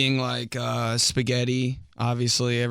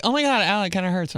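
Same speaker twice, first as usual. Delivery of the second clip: put-on girl's voice; 8 semitones higher, 3 dB louder, faster than usual. Girls voiced complaining.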